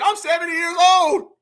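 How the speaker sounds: mocking, drawn-out angry yell; mock angry old man. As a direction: imitate an upset old man's protest. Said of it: "He's very upset and very mad and very scared".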